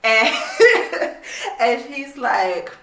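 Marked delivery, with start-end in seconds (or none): 0.4-1.5 high unvoiced burst of laughter. Real, not acted. Cracking up at the cringey book scene, loud airy laugh.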